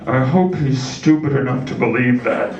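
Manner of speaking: low, conspiratorial dad voice; lightly voiced sneaky dad; dad aside to mom. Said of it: "looked at my mom like".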